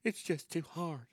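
soft mock complaint; imitating a feeble excuse-maker; mock whiner. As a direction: whine it quietly like a quitter. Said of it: "a lot of folks that go".

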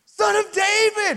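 full-voiced high yell, words stretched out. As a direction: yell to be heard after being hushed. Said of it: "just shouts louder".

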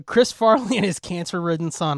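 usual speaking voice

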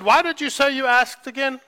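mock surprised woman asking again; surprised question. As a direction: ask it like you can't believe it. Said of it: "She said".

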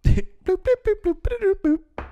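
high, bouncy bloop sounds; imitated ringtone bloops. Ignoring a ringing phone while driving, high bloop noises. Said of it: "just drive like".

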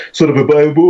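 stretched out mid-yawn. Real, not acted. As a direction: yawn creeping into the words. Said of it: "I'm yawning again".

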